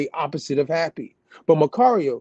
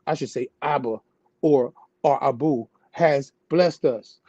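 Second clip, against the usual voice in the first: normal talking voice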